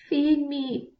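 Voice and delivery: the dying fire calls to the bears, pleading high; calling. A narrator's reading. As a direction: call weakly for food. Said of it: "Fire called".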